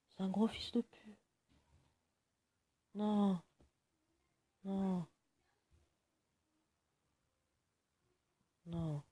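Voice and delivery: high-pitched wails of dismay as the match slips away. Real, not acted. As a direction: cry out against the losing fight.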